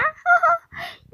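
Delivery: 높고 크게 터지는 비웃음; 조롱하며 깔깔; mocking laugh. 친구들 비웃음. (친구를 비웃는 높은 웃음)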